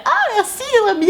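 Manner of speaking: very high-pitched mock squeal of pleasure; forced enthusiasm. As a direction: gush in an exaggerated, put-on high voice. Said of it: "tu vas évidemment pas te forcer à faire".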